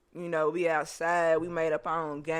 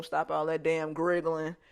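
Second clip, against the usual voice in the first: normal talking voice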